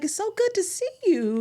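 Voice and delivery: high-pitched, happy retold hello; delighted greeting. Woman happily greeting a familiar cashier, delighted. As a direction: greet like an old friend, voice lifted high.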